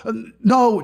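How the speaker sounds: high, mock-panicked refusal. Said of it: "She goes".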